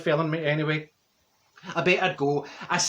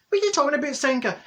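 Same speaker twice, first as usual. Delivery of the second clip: high-pitched incredulous retort; mock disbelief; 11 semitones higher, 3 dB louder, faster than usual. Telling Senga she must get a share, incredulous. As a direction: act out baffled protest to a friend. Acted disbelief at friend's bad news.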